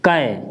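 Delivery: कै said as "kai", k then ai; drawn-out teaching "कै"; ka with ai matra. Shown as a demonstration. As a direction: model "कै" clearly for pupils. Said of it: "क्या पढ़ेंगे?".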